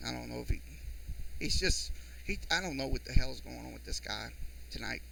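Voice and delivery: low, very soft grumble to yourself; muttered aside. Real, not acted. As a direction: grumble under your breath about someone. Exasperated aside about the listener.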